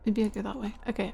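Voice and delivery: ordinary talk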